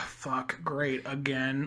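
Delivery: low, soft, sighing mock dismay; weary resignation. Voicing real culprits hearing of another movie, weary resignation. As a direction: sigh it out slowly, thoroughly fed up.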